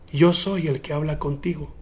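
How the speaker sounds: soft, hushed and low; solemn revelation. Retold or imitated. Jesus revealing himself to the woman, hushed and solemn. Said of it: "Oh my God, hermano".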